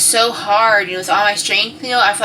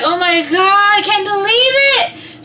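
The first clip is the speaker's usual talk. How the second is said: high, loud, sing-song amazement; thrilled disbelief; 13 semitones higher, 4 dB louder, slower than usual. Retold or imitated. Mom hearing the baby is coming, thrilled. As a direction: squeal it, overjoyed and amazed. Mom's acted reaction to news.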